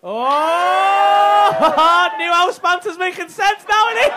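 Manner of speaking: loud, delighted shout; excited shout. Real, not acted. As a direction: shout it out with glee. Crowd erupts at a reveal.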